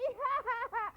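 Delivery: very high taunting giggle; mocking laugh. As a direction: laugh at her cruelly. Girl laughs at old woman.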